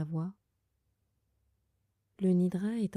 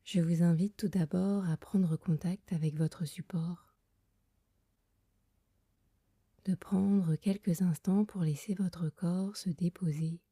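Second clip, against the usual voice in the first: guided meditation. Acted. Leads a Yoga Nidra meditation. (voix douce et lente de méditation guidée)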